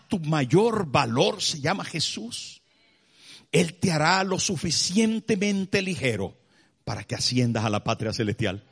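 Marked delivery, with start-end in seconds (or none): none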